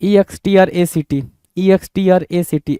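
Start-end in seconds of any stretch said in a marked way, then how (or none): none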